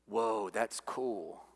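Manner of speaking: low, slow, very soft; quiet, drawn-out pretend reaction; understated mock awe. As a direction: murmur it slowly, deliberately underplayed. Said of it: "Isaiah's response isn't".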